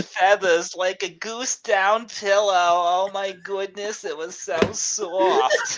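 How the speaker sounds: slow, high, awed explorer voice; hushed explorer wonder. Acted. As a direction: swoon over it like an explorer. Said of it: "Did I do the voice too".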